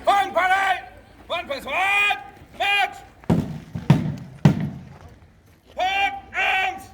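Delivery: high shouted drill orders; barked firing squad commands. Acted. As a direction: call the volley like a sergeant.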